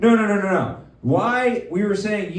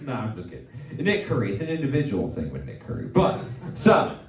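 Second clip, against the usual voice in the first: ordinary delivery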